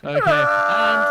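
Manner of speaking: high loud yelp of okay; sudden shout. Real, not acted. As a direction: blurt it out at the top. After laughing fit.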